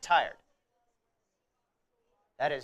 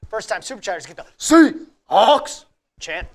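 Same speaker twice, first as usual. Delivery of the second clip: loud; 3 semitones higher, 12 dB louder than usual. Acted. Loud promo of chant.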